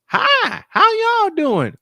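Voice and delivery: high, loud mock Southern belle accent; imitating wife's Southern accent. Husband mimics wife greeting fans in a mock Southern accent. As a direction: chirp it like a sweet Southern lady. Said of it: "the next thing I hear Jody say is".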